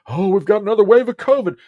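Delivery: high, loud mock-alarmed voice; sneering impression of a fearful official; mock government alarm. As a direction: ham up a panicky official announcement. Mocking the government's warning.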